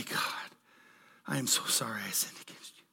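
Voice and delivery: fast, hushed whisper; whispered prayer. Pretend. Pastor voicing a sinner praying to God, whispered. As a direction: breathe it as a private confession.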